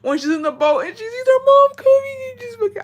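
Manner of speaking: high, squeaky tearful wail; real crying, voice pushed very high. Real, not acted. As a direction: wail it through tears, pitch way up. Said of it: "I'm crying all over again".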